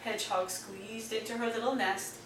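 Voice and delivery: ordinary delivery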